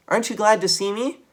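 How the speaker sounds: high, loud little nephew voice; Benjamin's squeak. A narrator's reading. Nephew Benjamin greeting his uncle, high squeaky voice. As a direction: squeak it like an eager little mouse.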